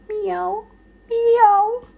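high, rising pitiful meow; whimpering meow. A narrator's reading. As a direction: mew like a lost cat. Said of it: "wimmerte es".

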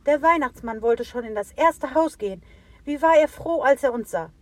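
high, loud animal chorus; excited animals. A narrator's reading. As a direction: report back out of breath.

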